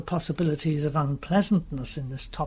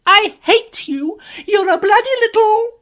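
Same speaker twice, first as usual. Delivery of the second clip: hysterical screaming; 13 dB louder than usual. Acted. Jean screaming hatred at Uziette, breaking into sobs. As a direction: scream it as you break down crying.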